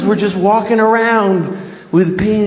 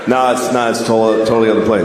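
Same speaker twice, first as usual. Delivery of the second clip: much lower, flat and patient; deadpan native reply; 11 semitones lower, faster than usual. Retold or imitated. Native man corrects settlers, low and deadpan. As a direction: answer low and unimpressed. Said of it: "natives answering settlers".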